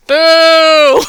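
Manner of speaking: loud playful boo. Real, not acted. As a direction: boo them loudly. Booing Bloodline.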